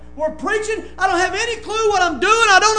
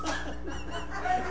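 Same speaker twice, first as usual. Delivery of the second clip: high laugh; 12 dB quieter than usual. Real, not acted. Laughing at his friend's stumble.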